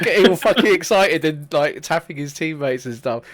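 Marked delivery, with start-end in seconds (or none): none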